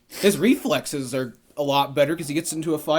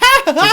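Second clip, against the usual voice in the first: high, loud imitated laughter; loud put-on "ha ha" burst; mocking crowd laugh; 13 dB louder than usual. Everybody on the bus laughing at Peter, mocking laugh. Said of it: "everybody's like".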